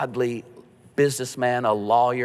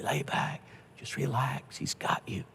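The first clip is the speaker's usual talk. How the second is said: soft, much quieter, gentle reassurance; tender whisper; 3 semitones higher, 10 dB quieter than usual. Retold or imitated. Pastor's last words to a dying believer, tender and hushed. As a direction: breathe the comfort out softly. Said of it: "They hear this tender voice".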